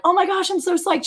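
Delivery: high thrilled squeal; high excited gush. Retold or imitated. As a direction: squeal with delight. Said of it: "And I was like".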